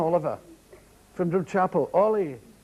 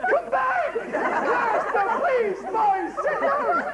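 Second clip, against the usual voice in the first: much higher, frantic mock plea; pleading teacher; 12 semitones higher, much slower than usual. Teacher pleading with boys walking out, high and frantic. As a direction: beg the boys to come back.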